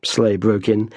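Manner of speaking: ordinary delivery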